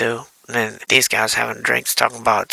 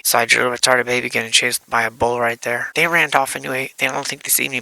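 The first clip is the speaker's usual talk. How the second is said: normal talking voice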